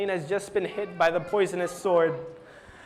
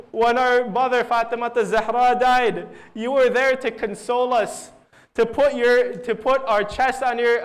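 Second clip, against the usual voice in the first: louder, anguished preacher's retelling of a son's lament; grief-stricken plea; 4 semitones higher, 5 dB louder than usual. Retold or imitated. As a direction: plead with a dying father, voice raised. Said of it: "Imam Hussein starts crying again".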